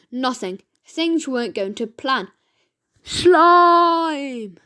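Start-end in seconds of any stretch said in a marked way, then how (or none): 3.0-4.6 put-on boy shouts the word loud and high, drawn out. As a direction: yell it out to summon something. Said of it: "the boy shouted again".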